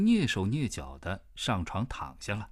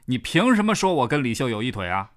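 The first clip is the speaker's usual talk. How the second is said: angry demand; 3 semitones higher, 8 dB louder, faster than usual. Acted. Man confronts coworker over rumor.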